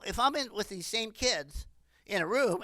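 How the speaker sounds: ordinary delivery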